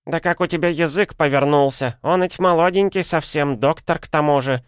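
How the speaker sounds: high, scandalised granny voice; возмущённая старуха. A narrator's reading. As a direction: reproach her as an outraged old woman. Old women rebuke the nurse. (старушечье возмущение, высоким голосом)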